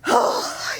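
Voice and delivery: imitated old man's weary sigh; long breathy sigh before answering; heavy sigh. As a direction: let out a big acted sigh. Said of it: "this little old man goes".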